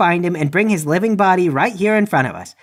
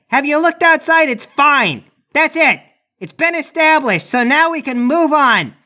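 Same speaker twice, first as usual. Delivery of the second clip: higher-pitched, blustering rant; noisy racket; 6 semitones higher than usual. Acted. Hollering at cell rats to cause a distraction, noisy racket. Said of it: "making a noisy racket".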